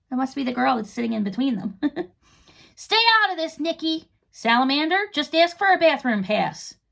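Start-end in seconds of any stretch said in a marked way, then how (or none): 2.8-6.8 bossy Rip. A narrator's reading. Rip telling Nikki to butt out, higher and bossy. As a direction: shut her down, then repeat her idea.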